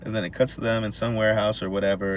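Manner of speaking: ordinary talk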